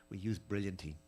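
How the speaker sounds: ordinary delivery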